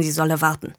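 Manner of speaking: usual speaking voice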